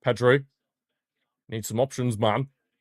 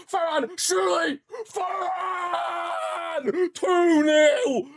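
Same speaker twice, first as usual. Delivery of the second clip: much higher, shouted, ecstatic; goal celebration scream; much slower than usual. Real, not acted. Fan screaming as his team scores the second goal, ecstatic shout. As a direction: scream it as the ball hits the net.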